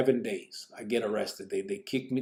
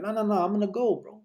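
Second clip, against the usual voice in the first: much higher, pushing back firmly; insistent; 9 semitones higher than usual. Retold or imitated. To Casper about the meeting, high insistent refusal. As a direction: refuse to be left out.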